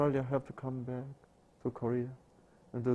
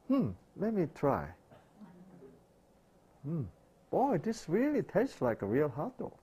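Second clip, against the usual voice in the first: higher, gently teasing Jesus voice; amused Jesus; 6 semitones higher than usual. Acted. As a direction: tease the host lightly as Jesus. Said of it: "Jesus will say".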